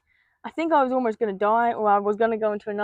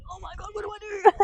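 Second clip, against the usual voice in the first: much higher, frantic question; panicked; 10 semitones higher than usual. Retold or imitated. Narrator reliving a panic attack, high and frantic. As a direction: let the panic jump up into the pitch. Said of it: "and I'm like".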